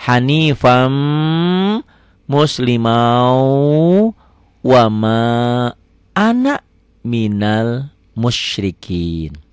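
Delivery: word-by-word recitation with long pauses; slow recitation. Shown as a demonstration. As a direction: recite slowly, stretching each phrase ending. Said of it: "perlahan ya".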